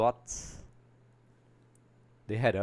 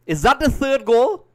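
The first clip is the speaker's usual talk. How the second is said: high, loud, drawn-out disbelieving cry; excited shouted question; 13 semitones higher, 13 dB louder, slower than usual. Real, not acted. Commentator sees a possible third goal, excited shouted question. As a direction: shout it, hoping it's a goal.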